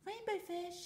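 high calling little fish voice; small fish calling. Acted. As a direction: call out like a tiny fish. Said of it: "he called".

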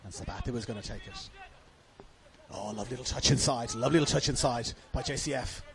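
ordinary delivery

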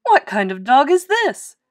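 puzzled trainer, voice swooping up; astonished question. A narrator's reading. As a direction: ask it in loud disbelief. Trainer asks about odd dog.

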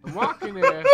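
very high, loud mock New York accent. Player pushing the running gag, high mock New York accent. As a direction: squawk it as a hammed-up New Yorker. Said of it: "to do the accent".

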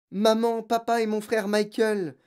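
put-on high, urgent child's explanation; distressed. A narrator's reading. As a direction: spill the news in dismay. Distressed girl explains to teacher.

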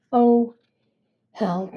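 listless, toneless cry for help; bored monotone, loud first word then sagging; unenthusiastic. Acted. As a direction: call out with no interest at all. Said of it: "without much enthusiasm".

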